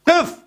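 one word thrown up high; preacher commanding the crowd to stand firm, high and sharp; urgent command. Acted. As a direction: call it out like a rallying order. Preacher urging support for refugees.

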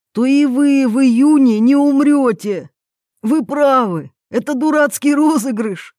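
high, loud, rising excitement; elated relief. A narrator's reading. Yuri telling Irina they won't die, elated. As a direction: exclaim it with growing conviction.

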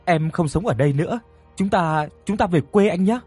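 high-pitched, urgent begging; desperate pleading. A narrator's reading. Woman begging Tuấn to take her home, desperate pleading. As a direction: beg him desperately to take you along. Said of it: "dập đầu rồi van xin Tuấn".

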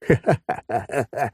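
bursting out laughing; a big hearty laugh. Acted. 007 laughs at the joke.

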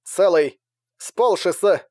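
radio voice breaking up; crackly cordless phone. A narrator's reading. Continued broken transmission.

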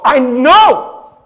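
loud, high exasperated yell; exploding. Retold or imitated. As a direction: re-enact blowing up at mom. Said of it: "I just explode".

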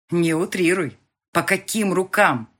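loud curt snap; rough retort. A narrator's reading. Boss rudely cuts off the heroine, loud snap. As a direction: cut her off rudely. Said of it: "грубо отрезал он".

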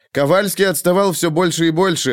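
ordinary delivery

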